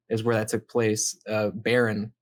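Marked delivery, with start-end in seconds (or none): none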